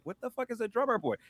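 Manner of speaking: high, fast, stunned question; asking her friend, bewildered and quick; baffled. Retold or imitated. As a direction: toss it out in disbelief. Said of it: "I'm like".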